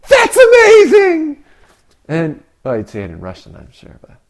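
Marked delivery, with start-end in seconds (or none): 0.1-1.4 much higher, loud exclamation of astonishment; hammed-up delighted cry; amazed shout. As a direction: shout it like a judge blown away. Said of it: "The stingiest, uh, Russian judge might say".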